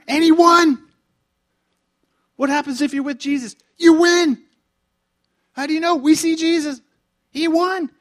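loud, high, triumphant preaching; full-force preaching. Real, not acted.

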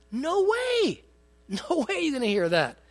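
high, incredulous scoff. Real, not acted. Preacher scoffs that no columnist would say it, incredulous. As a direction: brush the idea off in disbelief.

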